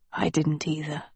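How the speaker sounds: whispered, voiceless and soft. A narrator's reading. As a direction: breathe it out in a whisper. Wolf's soft reply.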